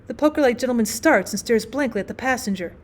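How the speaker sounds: usual speaking voice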